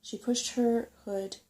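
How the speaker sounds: plain reading voice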